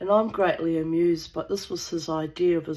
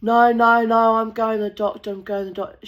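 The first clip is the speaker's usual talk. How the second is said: insistent Gail; 4 semitones higher, 9 dB louder than usual. Retold or imitated. Gail insists on seeing doctor.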